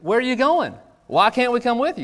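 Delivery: a hint of eager children tagging along; kids asking. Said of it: "they're asking".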